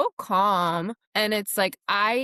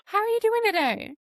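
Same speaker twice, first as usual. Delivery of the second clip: cheery barista greeting; 13 semitones higher than usual. Retold or imitated. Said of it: "she's like".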